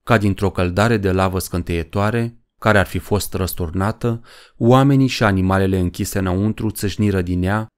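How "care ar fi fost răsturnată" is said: ordinary delivery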